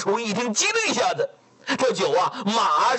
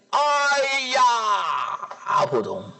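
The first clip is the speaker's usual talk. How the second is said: anguished cry; 3 semitones higher than usual. Acted. Father collapses on hearing.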